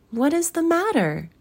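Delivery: high, otherworldly question; spirit's voice. A narrator's reading. Spirit asks the weeping Scrooge, high voice. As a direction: ask it in a high ghostly voice, falling at the end.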